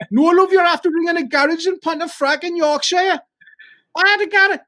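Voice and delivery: very high, loud Yorkshire garage lady; hammed-up Yorkshire voice telling a caller they've got the wrong number; comic Yorkshire "love" voice; stupid Yorkshire accent. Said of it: "we'd put on some stupid accent".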